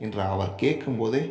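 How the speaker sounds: usual speaking voice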